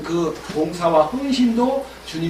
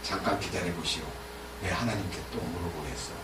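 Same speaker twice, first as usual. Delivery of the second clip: much lower and softer, sly and hushed; sly low aside; 12 semitones lower, 15 dB quieter than usual. Acted. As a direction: drop way down, as if stalling them slyly. Voicing Balaam stalling the envoys.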